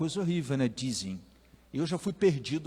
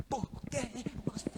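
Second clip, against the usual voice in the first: a touch of scolding; 9 semitones higher, 9 dB quieter than usual. Retold or imitated. Mother scolds after hug.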